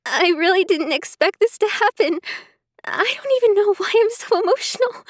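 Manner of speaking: high, fast, teary voice; tearful. Acted. Emily explaining her tears to Sarah, high and teary. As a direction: rush it out through tears.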